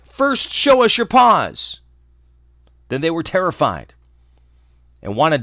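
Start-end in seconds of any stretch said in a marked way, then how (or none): 0.0-1.8 high, loud, slow demand; kids crying out. Acted. Wary kids at the door, high and loud. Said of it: "The little kids cried".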